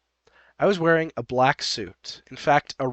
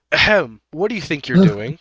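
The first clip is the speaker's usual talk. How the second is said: loud throat-clear, louder scolding demand; indignant interruption; 3 semitones higher, 9 dB louder than usual. Acted. Narrator voices Happy confronting OP among guests, indignant. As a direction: cut in loudly, outraged and officious.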